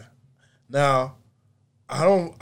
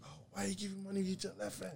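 whisper; 6 semitones higher, 16 dB quieter, faster than usual. Retold or imitated. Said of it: "It's like".

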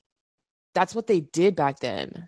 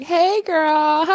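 put-on high, bubbly girlish hello; sweet greeting; 11 semitones higher than usual. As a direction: chirp it like a friendly assistant. Assistant's sweet phone greeting.

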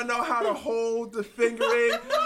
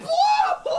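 shouted high through laughter; laughing shout; 6 dB louder than usual. Real, not acted. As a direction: yell it while cracking up. Laughing verdict on the bagpiper.

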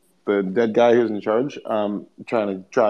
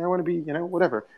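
mock whiny foreigner; 7 semitones higher, 4 dB quieter than usual. Continuing the imitated quote.

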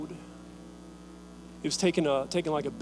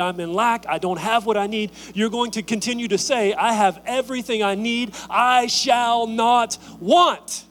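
loud, rising preacher's call, each line pushed higher; preaching at full force; 4 semitones higher, 4 dB louder than usual. Acted. Pastor urging the congregation to hold on, preaching hard. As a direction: build the sermon to its peak.

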